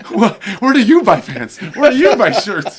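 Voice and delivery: high, loud, laughing excitement; squealing with delight through laughter; giddy starstruck. Retold or imitated. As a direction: gush like a starstruck kid. Starstruck fan quoting himself.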